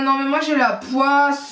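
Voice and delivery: high, slow, drawn-out lament; exasperated whine. Real, not acted. Vlogger cursing her bad luck, high slow whine. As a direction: drag it out in self-pity.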